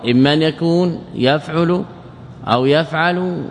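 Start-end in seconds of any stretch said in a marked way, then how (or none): 1.1-1.8 يفعل said yaf'ulu, with u after the ayn; damma on the ayn. Shown as a demonstration. Teacher listing the three cases, damma demonstration. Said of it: "ضم العين". 2.5-3.4 يفعل said yaf'alu, with a after the ayn; fatha on the ayn. Shown as a demonstration. Teacher listing the three cases, fatha demonstration. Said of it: "بفتح العين".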